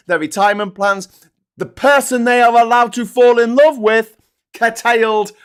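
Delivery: exasperated shouting at the audience; real indignant rant. Real, not acted. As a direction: hammer each point home loudly.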